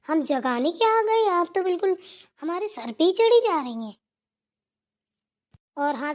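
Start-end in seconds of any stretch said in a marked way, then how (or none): 0.1-4.0 loud, higher and indignant, full of grievance; indignant outburst. Acted. To the sister she woke, loud indignant. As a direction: snap back at the ungrateful sister. Scolded after waking sister up.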